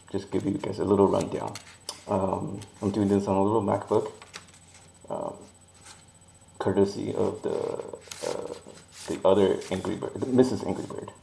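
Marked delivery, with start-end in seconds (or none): none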